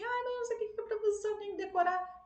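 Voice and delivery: high, fast whiny complaint; mock frustrated student. Student complains to teacher about memorising prepositions, whiny. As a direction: whine about having to memorise. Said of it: "Aí você fala assim".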